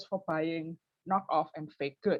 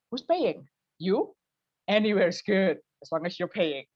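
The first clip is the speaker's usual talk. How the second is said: cheapo answer; 2 semitones higher, 6 dB louder than usual. Retold or imitated. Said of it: "My first answer would be".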